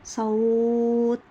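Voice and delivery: صوت stretched very slowly with a clear final t; teacher demo of صوت, final ت held; slow demo word. Shown as a demonstration. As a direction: draw out صوت and stop firmly on the ت. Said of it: "أما أجي أنطقها عند الوقف".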